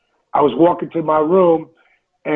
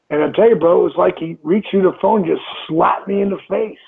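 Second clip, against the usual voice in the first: normal talking voice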